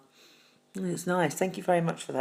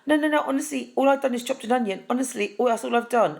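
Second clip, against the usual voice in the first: high, rushed protesting no's; mock-flustered denial, pitched way up; 6 semitones higher, faster than usual. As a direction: insist you're fine while caught crying.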